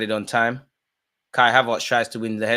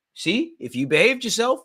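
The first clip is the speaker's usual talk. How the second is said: higher, gloating; mocking taunt; 11 semitones higher than usual. Real, not acted. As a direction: rub it in. Taunting injured player.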